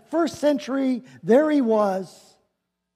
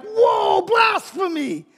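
outraged shock; 10 semitones higher, slower than usual. Retold or imitated. Religious leaders react in outrage.

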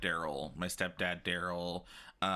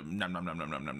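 nom-nom chomping, sinking lower; playful munching noises; 4 semitones lower, faster than usual. Shown as a demonstration. Showing a friend how you eat fried bologna, playful munching. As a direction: imitate gobbling down a sandwich. Mimes eating the fried sandwich.

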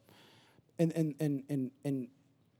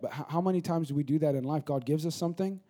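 ordinary talk

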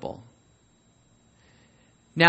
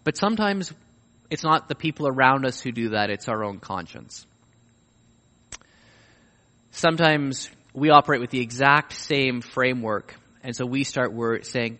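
normal talking voice

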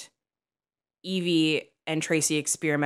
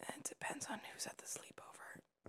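hushed conspiratorial whisper; 8 semitones higher, 23 dB quieter than usual. Real, not acted. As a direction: breathe it like a secret. Whispered joke, then said aloud.

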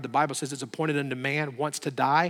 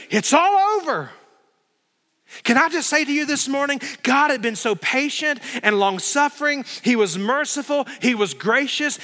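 loud, much higher fervent preaching; preaching at full force; 9 semitones higher, 6 dB louder than usual. Acted. Preacher thundering judgment to the congregation, full force. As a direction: drive it home to the congregation.

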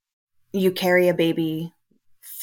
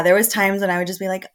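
normal talking voice

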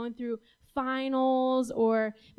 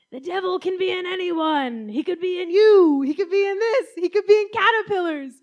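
higher, louder fire-and-brimstone preacher; preaching pastor; 7 semitones higher, 9 dB louder than usual. Retold or imitated. Pastor warning the congregation, in a loud preaching voice. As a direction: boom it out like a pulpit warning. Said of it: "I just hear the pastor say".

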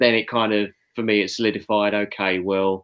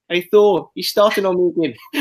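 imitating Korg; 9 semitones higher, 5 dB louder than usual. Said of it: "He's like".

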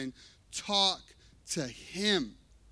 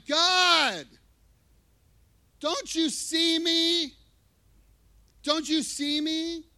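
loud, high, pleading cry to God; a drawn-out, desperate plea; 10 semitones higher, 7 dB louder, slower than usual. Acted. Acted desperate cry in boat.

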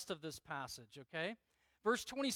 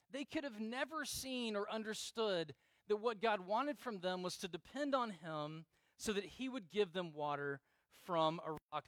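normal talking voice

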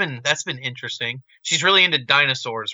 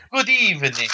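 fireside gentleman; 5 semitones higher than usual. Shown as a demonstration. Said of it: "a pipe and some brandy and a smoking jacket".

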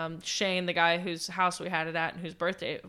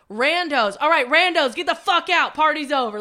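louder, bossy order to leave; mock party bouncer; 6 semitones higher, 7 dB louder than usual. Hosts kicking randoms out at dawn, louder mock order. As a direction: clear the room like a bouncer.

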